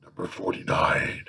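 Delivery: whispered, slow number reading; soft whispered read. Real, not acted. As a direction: breathe the number out. Reads question number whispered.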